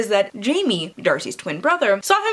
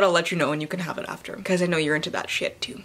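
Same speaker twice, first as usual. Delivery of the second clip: ordinary talk, nothing marked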